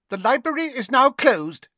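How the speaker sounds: put-on high, loud severe old librarian; shrill-free high crone librarian, loud; stern librarian. A narrator's reading. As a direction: announce it like a fussy vulture of a librarian. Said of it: "she said".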